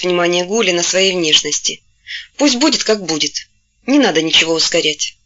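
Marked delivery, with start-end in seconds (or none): none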